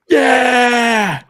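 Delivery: loud cheer of greeting; big hype entrance yell; excited shout. Real, not acted.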